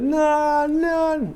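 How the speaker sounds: high, drawn-out call for nan; mock shout, very high and sustained. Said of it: "I literally shouted out for my nan".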